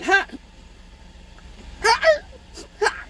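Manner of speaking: high, broken yelp of laughter; imitated laugh between whips. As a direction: laugh in bursts cut short by blows. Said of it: "trying to laugh in between the whips".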